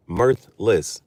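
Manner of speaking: "mirthless" drawn out very slowly; "mirthless" said slow and careful; slow pronunciation. Shown as a demonstration. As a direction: sound out "mirthless" syllable by syllable. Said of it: "easy to read".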